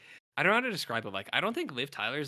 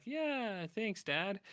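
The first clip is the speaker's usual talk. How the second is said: high, sweet mock little girl; 10 semitones higher, much slower than usual. The girl thanking her father in a high kid voice. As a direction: pitch it up like an innocent kid. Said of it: "And she's like".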